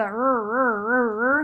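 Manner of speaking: imitating a truck engine revving with er sounds; repeated er engine noise; engine rev noise. Shown as a demonstration. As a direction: rev it like a pickup starting. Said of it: "onomatopoeia if you were saying the pickup started".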